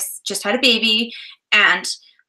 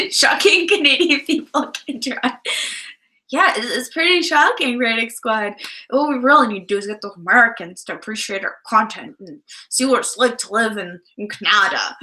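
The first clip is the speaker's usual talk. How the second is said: put-on terrible American accent. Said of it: "that's an American accent".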